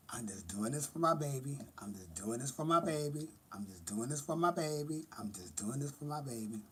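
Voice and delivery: soft murmur repeated under the breath; whispered mantra. Retold or imitated. Aunt telling herself why she works, whispering. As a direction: repeat it to yourself quietly, like a vow.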